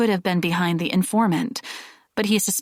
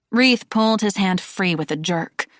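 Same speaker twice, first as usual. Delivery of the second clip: plain reading voice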